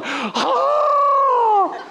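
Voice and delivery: put-on high shrieking children's cry of dismay; kids' wail. Grandkids in the car hear no raspberry, high wail. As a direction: scream like disappointed little boys. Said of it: "four little boys in the car going".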